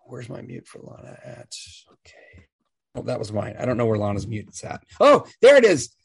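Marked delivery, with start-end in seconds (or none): 0.1-2.5 whispering to herself while searching; under-the-breath whisper; whispered aside. Real, not acted. Muttering aside while finding mute.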